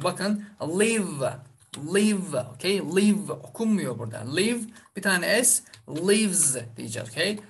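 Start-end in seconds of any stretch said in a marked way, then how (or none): 0.6-2.4 "live" as one syllable ending on v; silent final vowel. Shown as a demonstration. Teacher shows the written last letter is not read, silent ending. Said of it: "Hayır, tabii ki okunmuyor". 5.9-6.6 "lives" as livz, slowly; voiced z ending. Shown as a demonstration. Teacher models the s ending after live, voiced z. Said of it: "gibi telaffuz yapacağız".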